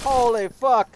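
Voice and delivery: loud, high exclamation; startled shout. Real, not acted. Player startled in the game, loud shocked shout.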